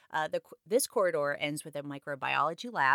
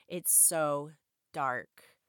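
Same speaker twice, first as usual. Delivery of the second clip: usual speaking voice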